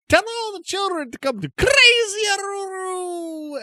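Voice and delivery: very high shouted sales cry; howling at the moon. Acted. Crazy trader advertising before ducking behind his counter, howling cry. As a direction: shout it out, stretching the name into a howl. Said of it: "as though he's howling at the moon".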